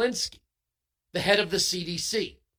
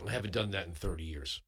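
ordinary talk, nothing marked

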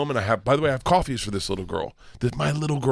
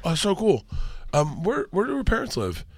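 higher, breezy fake-casual voice; fake-casual dad; 8 semitones higher, 4 dB quieter than usual. Dad quizzing his daughter, fake-casual and higher. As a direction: sound innocent while interrogating.

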